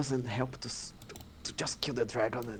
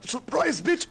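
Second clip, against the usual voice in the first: high, gleeful taunt; spring the ambush with glee; gleeful surprise shout; 5 dB louder, slower than usual. Acted.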